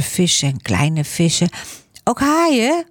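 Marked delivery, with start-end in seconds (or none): 2.0-2.9 put-on high child's voice, rising question on "haaien"; curious child. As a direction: ask "Ook haaien?" like an eager small boy, high. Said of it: "vroeg Laurens". (hoge kinderstem, vragend "haaien")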